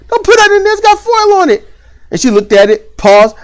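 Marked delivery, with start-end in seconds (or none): 0.1-1.6 high, urgent parent warning. Retold or imitated. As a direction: stop her fast, pitch up.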